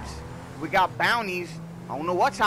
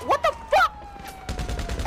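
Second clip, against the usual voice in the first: stunned disbelief; much slower than usual. Real, not acted. Out of ammo in game.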